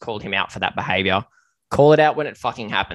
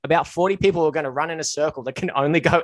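raised, higher voice; urgent mock warning; 6 semitones higher, 7 dB louder than usual. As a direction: call out to warn a newcomer in the crowd. Acting out a warning to someone.

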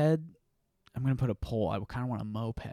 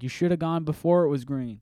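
mock honker; 6 semitones higher, 8 dB louder than usual. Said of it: "They're like".

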